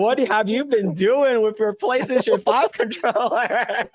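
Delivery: can't keep a straight face while asking; laughing question. Real, not acted. Teasing his friend about his oily controller, laughing.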